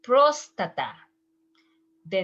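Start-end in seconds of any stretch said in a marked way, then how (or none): 0.0-0.9 próstata said the Spanish way, rolled r, stress on -tá-; Spanish pronunciation. Shown as a demonstration. Teacher models próstata in Spanish pronunciation. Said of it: "In Spanish, you say".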